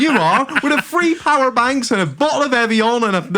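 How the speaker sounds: high-pitched comic accusation; mock outrage. Host to co-host exposed as that passenger, in mock outrage. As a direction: accuse a mate in gleeful mock fury. Teasing friend as the annoying passenger.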